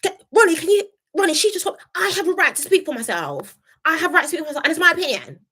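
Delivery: high-pitched imitation of indignant protest; Casey. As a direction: act out standing up for oneself, pitched high. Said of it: "Casey was like".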